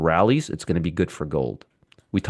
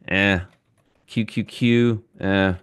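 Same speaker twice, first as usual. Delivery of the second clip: ordinary delivery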